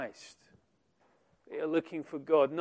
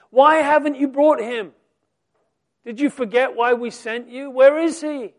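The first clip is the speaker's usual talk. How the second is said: loud, higher demanding voice; angry priests; 7 semitones higher, 10 dB louder than usual. Acted. Chief priests demanding officers explain, angry and loud. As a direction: demand answers, loud and rising.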